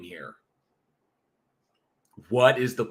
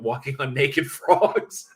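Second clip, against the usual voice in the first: laughing; 3 semitones higher, slower than usual. Real, not acted. Laughing through read chat line.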